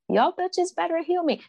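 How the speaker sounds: high-pitched, playful bossy order; sing-song mock threat, pitched high; mock demand. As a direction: jokingly order the plants around in a high voice. Joking demand made to her plants.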